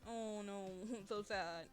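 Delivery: ordinary talk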